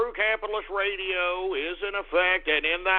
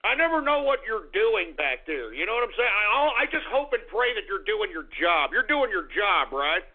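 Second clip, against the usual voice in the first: ordinary talk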